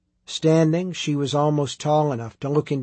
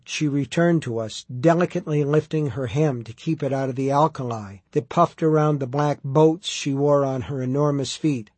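ordinary delivery

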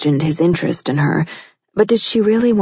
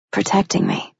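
whispering; 4 semitones higher, 4 dB quieter than usual. A narrator's reading. Heroine's soft answer.

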